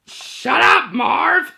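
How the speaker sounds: high, punchy shout at a partner; quoted film yell. Acted. Quoting Home Alone to introduce it, mock yell. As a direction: yell it like a movie catchphrase.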